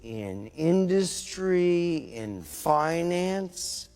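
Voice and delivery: slowed way down. Shown as a demonstration. Line replayed slowed down.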